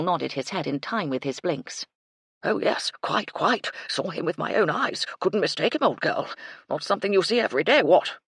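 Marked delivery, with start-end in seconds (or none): 2.4-8.2 put-on old officer's chatty certainty; blustery colonel. A narrator's reading. Colonel vouching for what he saw, blustery. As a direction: confirm it like a blustering old soldier. Colonel confirms his sighting.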